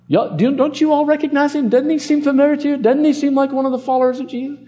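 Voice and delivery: high-pitched mock busybody stirring up a mob; accusing bystander. Servant girl rallying bystanders against Peter, accusing. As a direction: pitch it way up, quick and insistent.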